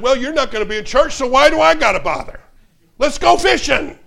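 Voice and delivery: high, loud and quick; the pastor grinning at his own excuse; gleeful pastor. Retold or imitated. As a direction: cheerfully brush off church and head out. Pastor voiced in funny story.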